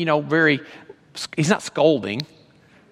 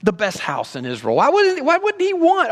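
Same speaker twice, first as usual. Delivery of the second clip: much higher, baffled questioning; puzzled Bible student; 4 dB louder than usual. Retold or imitated. A Bible student puzzling over the text, baffled and high. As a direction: ask it like a student who can't make sense of it. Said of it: "any serious Bible student would come to this and go".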